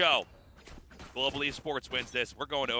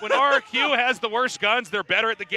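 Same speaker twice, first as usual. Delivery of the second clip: excited; 9 semitones higher, 13 dB louder than usual. Real, not acted. Excited caster rant.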